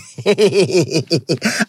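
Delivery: long rippling laugh; gleeful giggle. Real, not acted. Host relishing a rival's tears, rippling gleeful laugh. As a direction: break into a drawn-out run of giggles.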